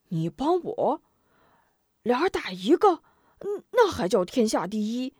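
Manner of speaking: disdainful rising questions; disappointed scorn. A narrator's reading. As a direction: scoff at the offer. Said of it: "失望地白了颜真一眼". (失望不屑地反问)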